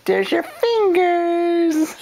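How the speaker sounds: high slow sing-song. Real, not acted. Mother finds baby's fingers, high slow delighted baby talk. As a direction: draw it out with delight.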